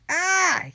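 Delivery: high loud howl of pain; pained yell. A narrator's reading. Villain hit on the knee, yelling in pain. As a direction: cry out as a cane hits the knee. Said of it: "he yelled".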